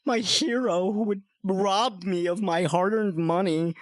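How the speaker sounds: high, slow, hammed-up indignation; theatrical offended squeal; mock outrage. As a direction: act scandalized in a put-on voice. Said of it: "I said".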